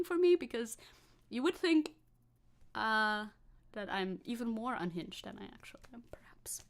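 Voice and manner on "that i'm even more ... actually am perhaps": whispered aside. Pretend. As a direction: whisper it like a confession. Conspiratorial aside about being unhinged.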